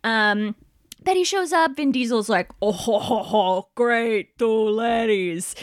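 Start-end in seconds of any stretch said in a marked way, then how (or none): 2.6-5.5 lightly voiced Vin Diesel with a bad accent; mock lecherous bad-accent chuckle, slow at the end. Storyteller voicing Dom greeting twin sisters, bad accent. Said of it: "I am giving him, like, a bad accent".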